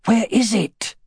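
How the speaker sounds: high-pitched, frantic question; urgent, desperate inner cry at normal volume; frantic thought. A narrator's reading. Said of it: "he thought, frantic".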